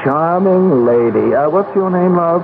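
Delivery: a fussy assistant ushering people to the great man; eager clerk voice. Acted. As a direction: chirp out the introductions like a busy little clerk. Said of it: "will be his clerk".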